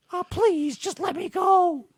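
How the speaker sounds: high whiny plea; mock pleading squeak. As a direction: beg like a scared cartoon.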